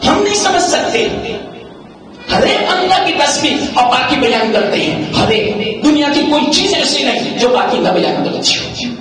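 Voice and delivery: impassioned religious exhortation; fervent preaching. Real, not acted. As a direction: drive the point home like a preacher. Preaching rises again.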